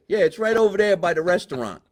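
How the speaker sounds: mock the casual way of giving directions; imitating sponsor's guy. Host teases chef by mimicking the sponsor's guy, mocking imitation. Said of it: "doing what the guy from Minuteman Press said".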